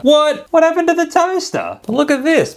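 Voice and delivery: high-pitched, indignant disbelief; outraged, high squeal of a question; dismayed surprise. Real, not acted. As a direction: jump up high in shock at a letdown. Dismayed at downgraded prize.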